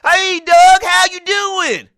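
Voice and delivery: high, loud country hillbilly holler; mock rural South Carolina twang. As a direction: stretch it out like a country western greeting. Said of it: "these jokers sounded like a country western album".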